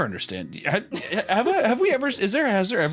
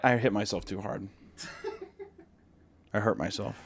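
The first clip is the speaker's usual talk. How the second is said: ordinary talk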